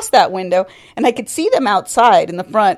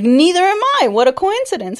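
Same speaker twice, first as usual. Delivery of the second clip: eager delight; 9 semitones higher than usual. Retold or imitated. Acts out the eager boy.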